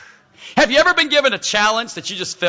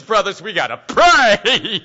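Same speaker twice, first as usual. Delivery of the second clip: mock panic, slow and louder; freaked out; 6 dB louder, slower than usual. [name] running back to his brothers, mock freaked-out plea. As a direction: play a frightened plea, leaning loudly on the last word. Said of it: "He's freaked out".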